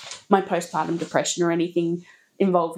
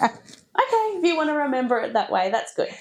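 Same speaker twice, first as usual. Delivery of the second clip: amused mock reply; 5 semitones higher than usual. Mum replying to daughter, amused.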